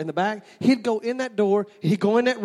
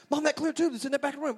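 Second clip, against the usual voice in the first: put-on insistent kid; 7 semitones higher, faster than usual. Child arguing back with mother.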